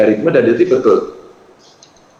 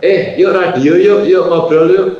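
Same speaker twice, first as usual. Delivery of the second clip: lively invite; 6 semitones higher, 3 dB louder, slower than usual. Acted. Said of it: "voicing a radio invitation".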